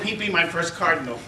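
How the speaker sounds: ordinary talk, nothing marked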